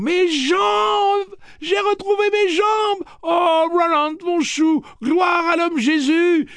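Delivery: very high, loud, ecstatic cries; joyful screaming. Acted. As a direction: shout the miracle to the sky. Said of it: "hurlait-elle à plein poumon".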